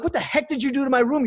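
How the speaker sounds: high, loud indignant outburst; mock outrage. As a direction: react as if you just saw the mess. Coming home to ransacked room.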